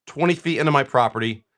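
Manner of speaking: ordinary delivery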